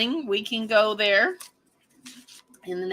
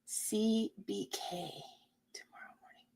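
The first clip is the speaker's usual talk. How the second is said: very soft, fading into a whisper; murmuring to oneself; 2 semitones lower, 16 dB quieter than usual. Real, not acted. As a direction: puzzle it out under your breath. Puzzling over message to herself.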